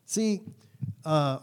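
ordinary talk, nothing marked

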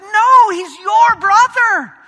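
father entreating his bitter son; pleading father. Retold or imitated. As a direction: beg your son to come in gently but urgently. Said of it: "his father pleads with him".

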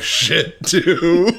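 laughing. Real, not acted. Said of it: "that I can't stop laughing".

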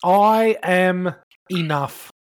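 very slow, drawn-out mantra; slow affirmation. Pretend. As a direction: stretch each word like a mantra. Reciting a self-help affirmation.

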